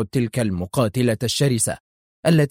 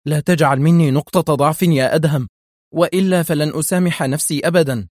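higher, louder, insistent plea; stern shout; 5 semitones higher, 5 dB louder than usual. A narrator's reading. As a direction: call out firmly, voice raised. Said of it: "صاح أحمد في صرامة". (صياح بصرامة)

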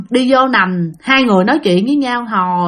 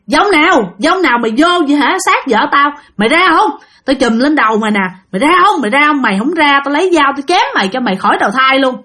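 angry threat; 3 semitones higher, faster than usual. Acted. Husband threatening the spirit possessing his wife, furious. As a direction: threaten the ghost out of her body.

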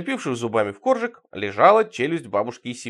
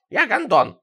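high, louder quacking call-sign; 7 semitones higher, 5 dB louder, slower than usual. A narrator's reading. Сидорыч giving his radio call-sign, high quacking voice. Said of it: "его крякающий голос".

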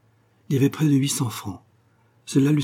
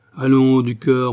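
loud, hearty urging; rally a faint-hearted friend; rousing encouragement; 3 semitones higher, 8 dB louder, slower than usual. Acted. Sénéchal bucks up pale Toussaint before the meeting, rousing encouragement.

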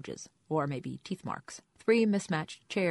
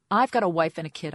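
angry; 9 dB louder than usual. Acted. Anger swells, loud outburst.

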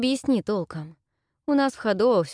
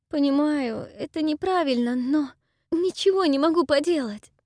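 put-on higher, pleading girl's voice; tearful young woman; 7 semitones higher than usual. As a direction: confess through held-back tears. Dayra's tearful confession. (голос расстроенной девушки)

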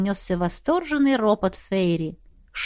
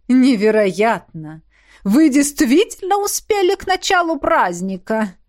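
exclaiming; 3 semitones higher, 5 dB louder than usual. A narrator's reading. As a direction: gush with venomous wonder. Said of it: "воскликнул Фейри". (громко восклицая, с ядовитым восторгом)

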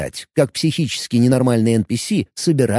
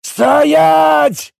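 high, loud, drawn-out command; bellowing; 13 semitones higher, 5 dB louder than usual. A narrator's reading. Crazed warrior chasing the player, bellowing. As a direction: keep hollering after the fleeing man. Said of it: "орал он".

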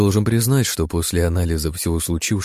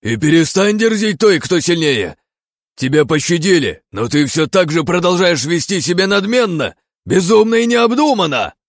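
furious shout; 11 semitones higher, 6 dB louder than usual. Acted. Envoy keeps raging at hero.

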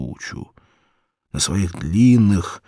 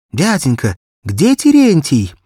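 put-on high, loud child's voice, anxious; a frightened little girl begging for help; little girl pleading; 8 semitones higher, 8 dB louder than usual. Barefoot girl asks every passer-by, high pleading child voice.

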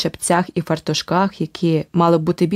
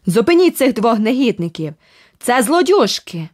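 roaring pursuer; 5 semitones higher than usual. Acted. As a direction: rouse the street against them. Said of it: "хрипке ревіння".

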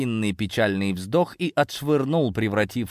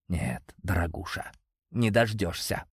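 soft menace; 4 semitones lower, 6 dB quieter than usual. Acted. Abuser taunts quietly.

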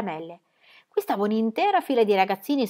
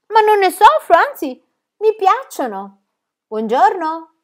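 loud, much higher and merry; loud, high, delighted child; cheerful loud; 11 semitones higher, 9 dB louder, slower than usual. Acted. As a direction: proudly boom it out. Pippi answering the shopkeeper.

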